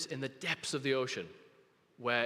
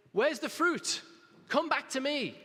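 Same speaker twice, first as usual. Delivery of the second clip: high, louder call from God; 10 semitones higher, 4 dB louder than usual. Acted. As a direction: call out to the lost. Preacher voices God calling out.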